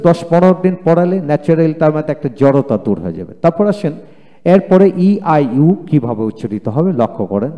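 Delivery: normal talking voice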